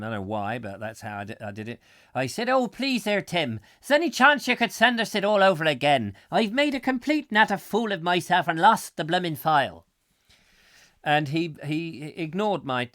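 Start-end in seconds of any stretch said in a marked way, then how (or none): 2.5-9.8 loud, hammed-up Irish pleading; Irish accent. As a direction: beg in a terrible clichéd Irish accent. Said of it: "I said it in an Irish accent".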